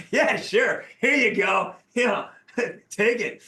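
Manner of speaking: high, loud, laughing acceptance; giddy mock eagerness. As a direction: act out being thrilled to hand it over. Acts out delighted response to autograph request.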